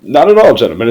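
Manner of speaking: high, loud jovial reply; exclaiming. Real, not acted. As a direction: toss it off with big cheer. Replying to teasing hosts.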